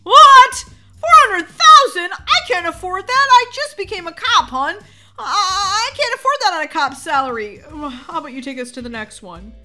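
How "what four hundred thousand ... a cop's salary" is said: high, very loud disbelief; shocked outburst. Acted. New cop hears the house price, shocked outburst. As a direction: shout it in outraged shock, easing slightly.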